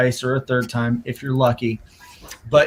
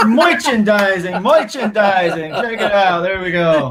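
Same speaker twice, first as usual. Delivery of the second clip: higher, slow, laughing sales-pitch chant; mock pitchman; 6 semitones higher, 4 dB louder, slower than usual. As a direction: hype up the merch like a hammed-up salesman. Playful pitchman bit about merch.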